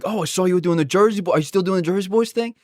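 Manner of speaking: high, exaggerated Long Island accent. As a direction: imitate a chatty Long Island classmate. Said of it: "they all speak with this thick Long Island, uh, accent".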